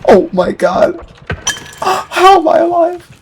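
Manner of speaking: high gasping amazement; stunned, panting relief; breathless disbelief. Real, not acted. Narrow escape.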